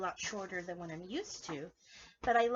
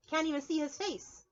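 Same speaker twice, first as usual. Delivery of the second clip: usual speaking voice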